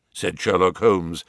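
plain reading voice

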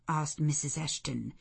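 ordinary delivery, nothing marked

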